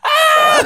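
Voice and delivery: very high-pitched mock scream; imitated panicked scream. Paul fleeing down the street, imitated high scream. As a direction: scream like someone running for his life. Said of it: "Paul running down the street going".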